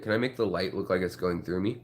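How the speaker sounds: ordinary talk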